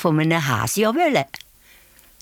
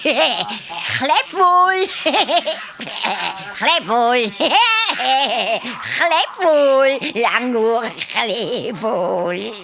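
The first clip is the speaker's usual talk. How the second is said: high laughing singsong taunt; mocking monkeys; 6 semitones higher, much slower than usual. A narrator's reading. Monkeys sing-song their taunt at the hare. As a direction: chant the taunt like giggling monkeys.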